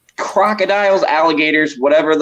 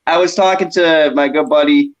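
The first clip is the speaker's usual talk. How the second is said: usual speaking voice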